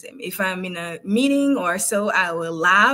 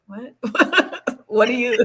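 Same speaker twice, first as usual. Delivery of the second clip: baffled quote broken up by laughter; giggly mock bewilderment; laughing imitation; 3 semitones higher, slower than usual. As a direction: mimic confusion while cracking up. Cracks up quoting puzzled colleagues.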